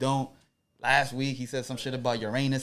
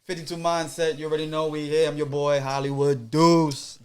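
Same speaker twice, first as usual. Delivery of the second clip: hype host intro; 3 semitones higher, 11 dB louder than usual. Acted.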